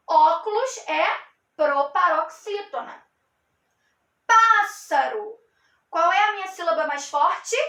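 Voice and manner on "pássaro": "Pássaro" slow, with a loud, long "Pá"; teacher sounding out "Pássaro", first syllable heavy; stressed first syllable. Shown as a demonstration. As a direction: punch and stretch the "Pá" of "Pássaro". Shows which syllable is strongest.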